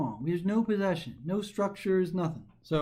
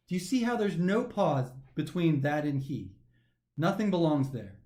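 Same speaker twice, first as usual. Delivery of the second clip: usual speaking voice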